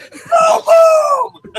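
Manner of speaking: mock yelling fan. Imitated fan yelling at family.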